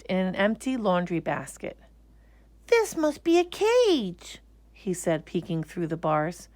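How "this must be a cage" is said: high, slow wary bear; uneasy little bear. Acted. Bear peeking through basket bars, uneasy. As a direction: peer in doubtfully. Corduroy voiced.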